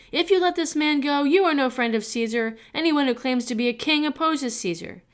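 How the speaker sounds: high, loud mob shout. A narrator's reading. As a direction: threaten him with the crowd's roar. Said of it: "kept shouting".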